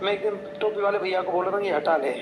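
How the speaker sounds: usual speaking voice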